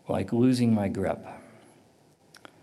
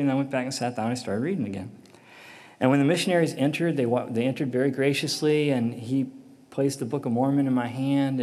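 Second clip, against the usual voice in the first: usual speaking voice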